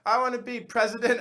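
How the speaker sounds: high, loud little-kid imitation; mock child's voice. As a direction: pipe up like a child with a big dream. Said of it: "one of the kids was like".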